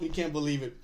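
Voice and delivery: normal talking voice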